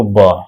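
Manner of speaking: plain b sound for B; letter sound b. Shown as a demonstration. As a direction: say ब as a bare b with a short vowel, not बी. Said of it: "बी को बोलना है आपको".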